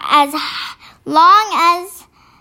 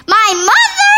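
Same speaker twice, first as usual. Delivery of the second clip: "My mother?" rises high and loud in disbelief; surprised giant cry; 7 semitones higher, 5 dB louder than usual. A narrator's reading. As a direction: cry out in shock, higher and louder. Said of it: "cried the B F G".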